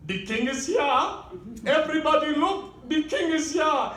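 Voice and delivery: put-on high, booming proclamation in a Ugandan accent; mock-regal Ugandan-accented cry; thick Ugandan accent. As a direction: announce yourself grandly, imitating a thick Ugandan accent. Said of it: "with this thick Ugandan accent and says".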